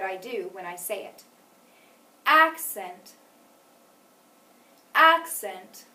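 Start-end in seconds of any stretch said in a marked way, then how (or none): 2.2-3.1 first syllable of "Accent" punched louder and higher; stress on first syllable. Shown as a demonstration. Teacher has pupils listen to the first syllable, stressed "Accent". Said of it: "anything different that I did on the first syllable". 4.9-5.8 "Accent" with a loud, high first syllable, falling after; stress on first syllable. Shown as a demonstration. Teacher repeats it for pupils to notice, stressed "Accent". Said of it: "It was louder".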